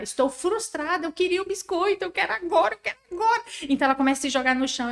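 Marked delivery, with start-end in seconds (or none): 0.0-3.4 put-on high whining child, rising as she insists; a small child demanding the cookie, getting higher; whiny child building up. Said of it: "a criança entra". (criança birrenta, voz aguda e insistente)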